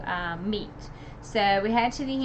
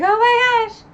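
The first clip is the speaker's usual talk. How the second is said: high, loud panicked fan cry; panicking reader, much higher and louder; freaking out; 9 semitones higher, 4 dB louder than usual. Retold or imitated. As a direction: shriek it like your favourite town is burning. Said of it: "I was, like, freaking out".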